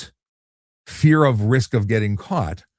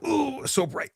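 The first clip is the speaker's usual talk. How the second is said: mock dazzled gasp; 5 dB quieter, slower than usual. Blinded by light through window.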